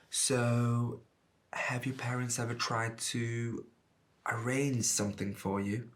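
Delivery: slow, soft, hesitant asking; halting and hushed, rising at the end; tentative question. Acted. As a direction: feel your way carefully into an awkward question. Laura finally asks delicate question.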